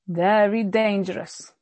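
normal talking voice

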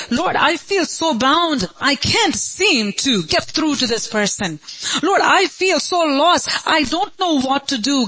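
high, anguished cry to God; hopeless plea. Acted. Believer who feels bound and lost prays to God, hopeless pleading. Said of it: "When you feel hopeless".

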